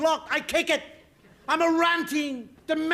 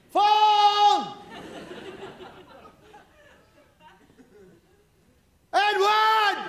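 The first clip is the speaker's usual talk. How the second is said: higher, drawn-out call up the stairs; calling upstairs; 7 semitones higher, much slower than usual. Acted. Dad at the bottom of the stairs calls his son, hollering. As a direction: holler for someone floors away.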